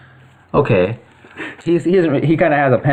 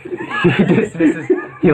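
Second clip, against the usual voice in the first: laughing; 4 semitones higher, much slower than usual. Real, not acted. GM laughing mid narration.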